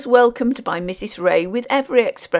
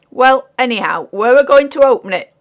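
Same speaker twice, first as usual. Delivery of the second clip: loud, higher-pitched insistence; dogged; 5 semitones higher, 10 dB louder than usual. A narrator's reading. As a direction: dig in stubbornly as the porter. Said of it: "said the man doggedly".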